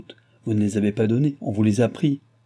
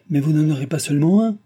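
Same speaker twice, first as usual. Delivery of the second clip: raised, louder protest; indignant exclamation; 5 semitones higher, 5 dB louder, faster than usual. A narrator's reading. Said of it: "s'écria le maître de poste".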